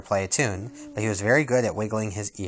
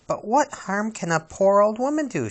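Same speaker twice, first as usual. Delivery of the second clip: put-on high, light girlish voice; innocent musing; 10 semitones higher than usual. Princess talks herself into trusting the old woman. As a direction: wonder innocently.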